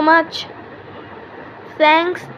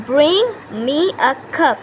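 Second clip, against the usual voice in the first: slow repeat; much slower than usual. Shown as a demonstration. Teacher repeats model phrase slowly.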